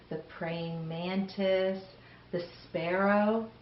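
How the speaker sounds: ordinary delivery, nothing marked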